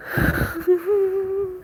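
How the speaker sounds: shaky crying sigh. Real, not acted. Crying alone after being threatened.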